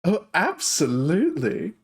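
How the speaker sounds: dark elf shopkeeper greeting surprise customers, high and gushing. Acted. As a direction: coo at unexpected guests. Trish voice continues.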